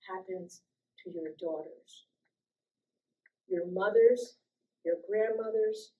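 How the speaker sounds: low, soft and measured, steady throughout; very calm. Retold or imitated. To her attackers, a calm, quiet, steady plea. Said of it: "And I said very calmly".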